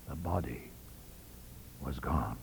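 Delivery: ghost-story hush at the reveal; hushed dread. A narrator's reading. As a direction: let it sink into a horrified hush. Dread revelation of the missing body.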